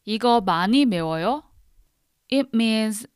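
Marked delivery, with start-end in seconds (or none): 0.1-1.4 fast Korean question. Shown as a demonstration. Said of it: "you can ask a question like".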